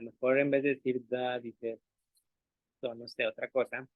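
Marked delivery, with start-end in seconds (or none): none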